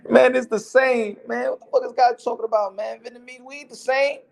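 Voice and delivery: fired up, insulted, demanding answers; offended friend. Retold or imitated. Imitating the Vietnamese friend telling off the dude, high and indignant. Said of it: "'Cause the guy said".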